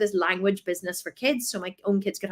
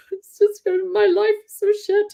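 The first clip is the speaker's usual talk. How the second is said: high, slow, crying voice; tearful despair; 12 semitones higher, 5 dB louder, slower than usual. Retold or imitated. Exhausted mum feeding the baby, crying. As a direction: let it crack with tears.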